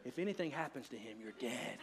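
very low, hushed mock warning; secretly mouthed threat. Wife warning husband out of son's earshot, hushed retold threat. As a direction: imitate a wife's quick, barely audible threat. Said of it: "she mouths so that he can't hear her".

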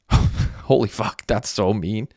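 slow, breaking up at a jab; laughing. A narrator's reading. Messi reacting to Derek's insult, laughing. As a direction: say it through an acted laugh. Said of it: "Messi laughed and spit up his drink".

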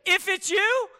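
can you believe he said that; mock-incredulous echo of Peter; incredulous repeat. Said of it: "Come on, man".

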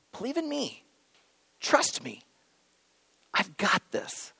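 dropping to a soft, near-whispered comfort; tender reassurance. Retold or imitated. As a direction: hush it down to reassure scared friends. Jesus comforting troubled disciples.

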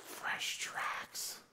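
slow, soft breathy promise; slow hush, drawing them in; hushed whisper. Acted. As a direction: whisper it like a reward waiting. Climax of sermon illustration.

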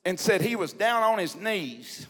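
ordinary talk, nothing marked

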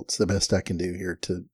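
ordinary delivery

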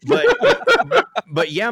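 laughs through the words; laughing. Real, not acted. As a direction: break into laughter on but yeah. Laughing mid-story.

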